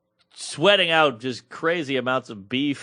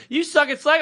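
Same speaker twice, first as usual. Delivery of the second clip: sassy instructor; 10 semitones higher than usual. Retold or imitated. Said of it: "She's like".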